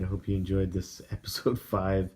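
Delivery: normal talking voice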